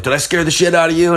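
high, fast taunt; mocking cop taunt, fast and high. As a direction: needle him cheerfully. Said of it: "the guy was like".

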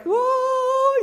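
a high, gushing mock "whoa" of delight; the staff's wide-eyed, swooning gasp at the little boy; awed whoa. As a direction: coo it like a crowd seeing a cute toddler. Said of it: "having that look like".

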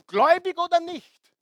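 loud, high preacher's cry; ringing shout from the pulpit; emphatic shout. Real, not acted. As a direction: drive the point home with a shout. Preacher hammering home a point.

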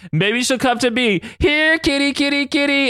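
high sing-song here kitty call; Toad calling kitty. Retold or imitated. Toad teasing Lance, high sing-song kitty call. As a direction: coax like calling a cat, way up high. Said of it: "Toad's like".

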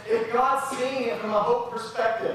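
high, loud, rising preacher's cry; preaching at full force. Real, not acted. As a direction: build the sermon to a passionate peak. Preacher builds to a climax.

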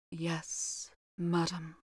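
low voice. A narrator's reading. Housekeeper answering Mrs Otis in a hushed low voice. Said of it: "replied the housekeeper in a low voice".

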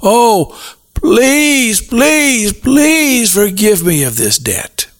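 high, begging please please please; pleading debtor. Retold or imitated. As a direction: plead for mercy like a desperate man. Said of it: "begs and pleads and cries and says".

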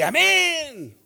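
high, loud, drawn-out congregational amen; a sudden high, loud amen from the pews; mock loud amen. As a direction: shout it like the crowd finally waking up. Mimics congregation finally answering.

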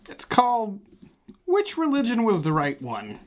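high, announcing the game title; game show announcer. Acted. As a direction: present it like a quiz host.

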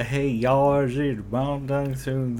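slow, drawled mock Cajun twang; mock Cajun accent. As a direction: poke fun at her odd Cajun accent. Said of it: "That is a weird accent".

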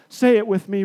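usual speaking voice

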